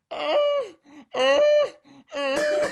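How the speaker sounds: high-pitched mock sex moans; imitated performative moaning. Mimicking the showy woman upstairs, hammed-up high moans. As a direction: moan in short, exaggerated high bursts. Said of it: "show how good it feels".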